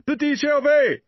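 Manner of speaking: high, loud calling out into the dark; searching for the lost boy in the dark; shouting a name. A narrator's reading.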